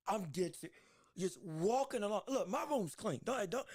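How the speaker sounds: ordinary talk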